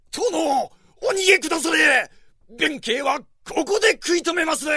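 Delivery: high, fast battle cry to his lord; urgent warrior shout. Acted. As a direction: yell it out, holding the line. Loyal warrior urges lord flee.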